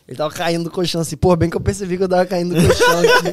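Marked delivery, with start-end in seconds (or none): 1.1-3.3 louder, laughing while quoting the buddy; laughing quote. Retold or imitated. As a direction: quote him while cracking up. Imita o parceiro, rindo alto. (citando o amigo rindo)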